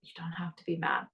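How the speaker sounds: whispered reassurance. Retold or imitated. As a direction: breathe it softly to him. Gently reassuring husband in whisper.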